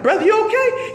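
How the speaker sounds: high-pitched concerned woman's voice. Retold or imitated. Sister checks on jittery stranger, high concerned voice.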